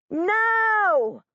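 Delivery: high, loud anguished no; girl screams as the boy mocks her dead brother. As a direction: scream it out in panic. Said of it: "I hear a scream".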